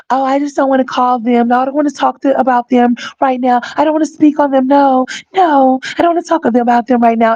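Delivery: high, prissy mock voice dodging questions; whiny high put-on as if too fragile to talk; mimicking a daughter who won't discuss family, high and fussy; mocking Olivia.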